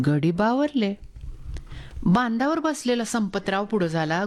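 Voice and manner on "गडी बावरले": ordinary delivery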